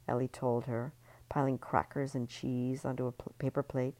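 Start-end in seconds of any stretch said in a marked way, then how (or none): none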